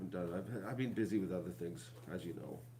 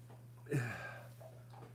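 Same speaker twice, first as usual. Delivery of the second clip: long sigh; 8 dB quieter than usual. Real, not acted. Frustrated vlogger sighs.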